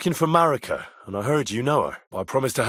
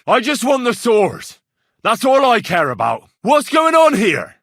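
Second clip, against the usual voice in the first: louder, higher, insistent; raised voice; 7 semitones higher, 6 dB louder than usual. Acted. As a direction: make yourself heard over the tension. Shouting in tense confrontation.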